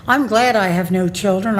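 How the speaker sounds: usual speaking voice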